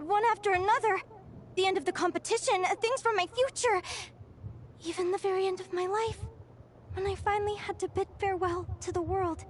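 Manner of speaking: ordinary delivery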